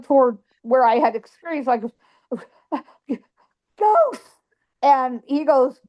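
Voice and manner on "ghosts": high, breathless blurted word; panicked gasp. Retold or imitated. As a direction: blurt it out in mock terror. Retold panic, pointing at ghosts.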